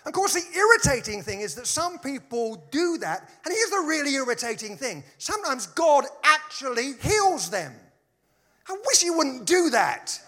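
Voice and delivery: high, loud comic exasperation; mock exasperation. Preacher griping to congregation about God healing people, mock exasperated.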